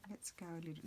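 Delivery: soft unvoiced whisper to herself; breathy whisper thinking aloud; whispered aside. Real, not acted. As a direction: murmur the idea under your breath. Crafter muttering plan to herself.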